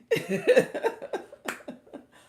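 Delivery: high, loud burst of laughter; hearty laugh. Real, not acted. Tickled by the language mix-up.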